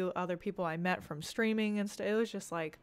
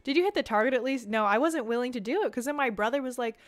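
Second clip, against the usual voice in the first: normal talking voice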